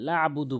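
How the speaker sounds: "লা আবুদু" with a short laa; unstretched mistake. Retold or imitated. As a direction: run "লা আবুদু" together, no long vowel. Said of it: "বলা যাবে না".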